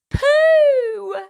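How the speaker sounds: plain reading voice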